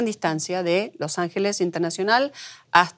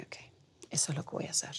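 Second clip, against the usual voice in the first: hushed private vow, barely voiced; whispered resolve; 4 semitones lower, 16 dB quieter, faster than usual. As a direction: whisper it to yourself, deciding.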